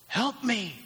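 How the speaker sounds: high-pitched cry for help, not shouted; lightly imitated desperate plea; mock crying out. Father begging Jesus for his son, a high mock cry. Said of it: "the father cries out".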